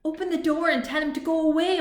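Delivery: bossy robot ordering a friend to shoo the visitor. A narrator's reading. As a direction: order them about, quick and loud. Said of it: "ordered Rusty".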